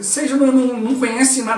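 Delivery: ordinary delivery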